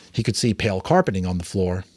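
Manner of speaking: usual speaking voice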